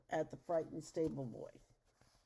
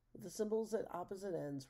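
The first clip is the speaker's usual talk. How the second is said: usual speaking voice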